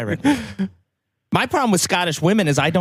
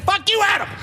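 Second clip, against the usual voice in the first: yelled; 12 semitones higher than usual. Retold or imitated. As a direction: yell it out at him. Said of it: "I yelled it out like that".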